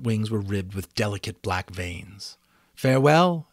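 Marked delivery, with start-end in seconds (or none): 2.7-3.5 higher, louder unicorn voice; a gentle goodbye from the unicorn; unicorn's farewell. A narrator's reading. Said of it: "she said".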